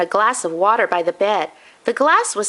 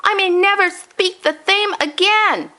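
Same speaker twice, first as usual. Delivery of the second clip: Grandpa moaning; 11 semitones higher than usual. Acted. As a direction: lament like a grandpa who lost his teeth. Grandpa continuing.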